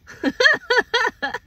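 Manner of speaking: high-pitched burst of laughter; laughing. Real, not acted. Laughing after acting out an exasperated outburst. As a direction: break into high, loud laughter.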